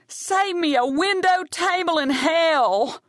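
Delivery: slow, very high screamed taunt; high, slow, theatrical scream. Acted. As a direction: scream it down the stairs at her. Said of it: "Evie screams down at Brandy Alexander".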